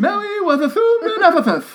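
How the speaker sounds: high, loud lisping, Mary was athumed in Ephethuth. Shown as a demonstration. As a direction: lisp every s, laughing. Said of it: "especially with a lisp".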